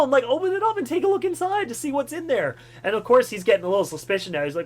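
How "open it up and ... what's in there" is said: high, coaxing voice; higher sing-song urging. Retold or imitated. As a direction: nudge him to look inside, playful. Urging kid to open box.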